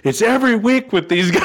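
high, loud, laughing complaint; exasperated woman. Retold or imitated. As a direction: voice a fed-up neighbour. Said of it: "and she goes".

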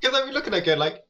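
ordinary talk, nothing marked

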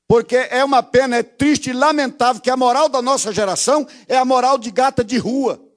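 fervent pulpit preaching. Acted. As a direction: build the sermon with indignation. Preacher building in force.

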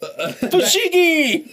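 high, loud announcer shout. Pretend. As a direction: yell the product name like an infomercial. Shouts a toy ad name.

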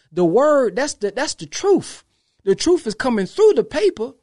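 high, ringing preacher's build; preaching climax. Real, not acted. Vlogger preaching to viewers about the paper's truth, soaring. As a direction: lift it up like a sermon peak.

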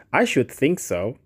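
ordinary delivery, nothing marked

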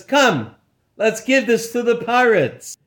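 lightly voiced eager doorman; friendly doorman voice. Doorman takes the gift.

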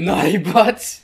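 high, slow, laughing echo; hammed-up naughty sound bite; mock naughty. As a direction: stretch out the silly rude phrase. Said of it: "you gotta say it like that".

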